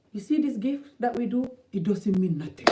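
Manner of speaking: usual speaking voice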